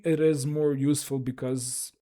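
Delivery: ordinary delivery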